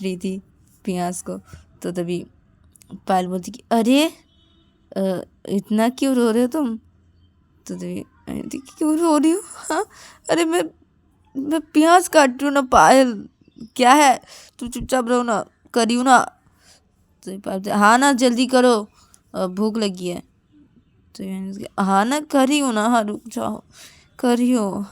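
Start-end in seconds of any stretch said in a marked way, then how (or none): 3.7-6.8 loud teasing. Acted. Payal teases crying Ananya. 8.8-16.2 higher, louder annoyed retort; a girl in tears from onions snapping at her friend; irritated snapping. Acted. Ananya snaps while cutting onions. 17.7-20.2 impatient demand. Acted. Payal demands hurry. 21.8-24.9 higher, louder fed-up answer; annoyed girl pushing back at a pushy friend; exasperated reply. Acted. Ananya impatiently answers.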